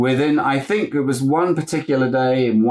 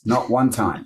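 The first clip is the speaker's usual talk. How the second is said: normal talking voice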